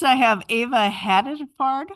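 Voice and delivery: usual speaking voice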